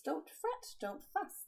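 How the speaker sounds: high squeaky soothing; squeaky mouse comforting. Acted. Mouse calming the upset bear, high squeak. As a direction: squeak it gently to calm him. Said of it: "Mouse squeaks".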